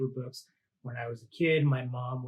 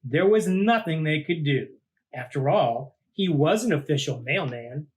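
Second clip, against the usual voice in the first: lively read-aloud storytelling voice; storybook narration; 5 semitones higher, 5 dB louder than usual. A narrator's reading. Host reading a monster picture book to kids, lively storytelling. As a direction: read it out like to a child at bedtime.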